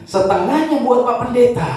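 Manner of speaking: put-on high, bright old-lady voice, pleased and carefree; grandma's voice. Old grandma cheerfully answering the pastor, high-pitched. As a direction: play the grandma answering without a worry.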